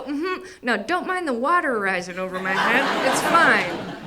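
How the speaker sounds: loud, rising mock reassurance; Tara, drowning therapist. Therapist reassures client as water rises, hammed-up loud reassurance. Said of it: "And she'd go".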